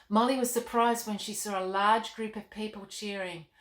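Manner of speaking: plain reading voice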